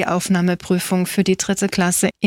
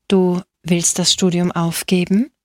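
plain reading voice

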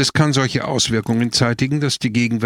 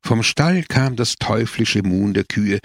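plain reading voice

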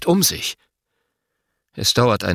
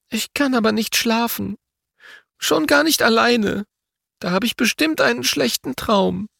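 put-on high small girl's voice, a touch teary; a scared girl afraid of bad dreams; tearful little girl; 14 semitones higher than usual. A narrator's reading. Girl voiced after sniffing.

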